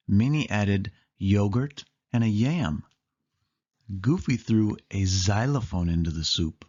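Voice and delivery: plain reading voice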